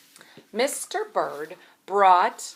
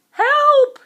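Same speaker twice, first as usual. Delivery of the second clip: panicked cry for help; 15 dB louder than usual. Acted. Bird hears egg squeaking, panics.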